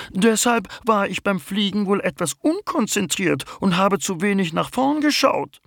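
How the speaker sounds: very high bumblebee voice, a little sleepy; the queen bumblebee introduces herself; young queen bumblebee. Acted. The bumblebee explains, ashamed. (Hummelkönigin mit sehr hoher Stimme, etwas verschlafen)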